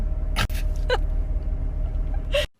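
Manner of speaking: breathless shrieking laugh, very high; high squealing laugh. Real, not acted. Reacting to husband's punchline.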